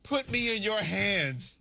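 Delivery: mock faith healer luring hurting fans in; faith healer coach. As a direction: lay hands on them like a TV faith healer. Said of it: "Kenny Atkinson said".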